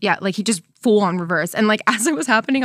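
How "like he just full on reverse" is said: ordinary delivery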